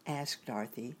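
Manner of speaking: ordinary delivery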